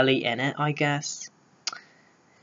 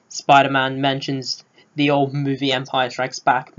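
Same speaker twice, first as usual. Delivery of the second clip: ordinary delivery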